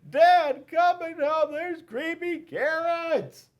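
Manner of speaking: high, loud, drawn-out wail; boy shrieking for his dad at night; screaming in terror. A narrator's reading. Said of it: "Jasper screamed".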